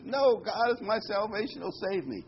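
mild mock stubborn preacher; stubborn refusal. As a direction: dig in, refuse the rope.

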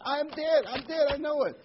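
high, loud insistent wail; hammed-up frantic old lady; insistent old wife. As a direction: convince him she has died. Joke, wife insists she's dead.

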